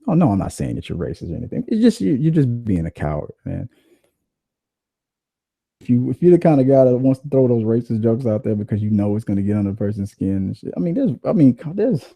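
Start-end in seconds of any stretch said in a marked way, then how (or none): none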